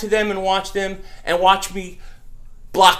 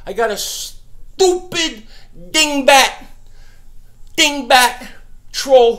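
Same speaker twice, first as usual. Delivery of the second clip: annoyed; 4 semitones higher, 5 dB louder, much slower than usual. Real, not acted. Fuming at troll in chat.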